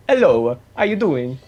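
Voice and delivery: a light put-on Italian hello; Italian accent. Trying an Italian accent on locals, lightly voiced. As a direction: greet like an Italian tourist. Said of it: "I'd purposefully be like".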